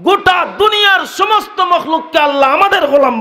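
very high, impassioned shouted sermon; full-force preaching. Real, not acted. Preacher swears by Allah to the crowd, shouted preaching. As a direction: cry it out high, falling on the last phrase.

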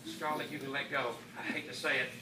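very soft, hushed voice. Acted. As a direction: whisper it so the egg can rest. Hushed listening at incubator.

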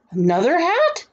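high, rising question; astonished. A narrator's reading. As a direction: ask in disbelief, pitch shooting up. Boy astonished at new hat.

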